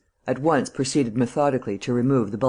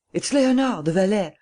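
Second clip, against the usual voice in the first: much higher, urgent warning cry; alarmed cry; 8 semitones higher than usual. A narrator's reading. Gilbert to Lupin, a high alarmed cry. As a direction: blurt it out in alarm. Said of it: "cried Gilbert".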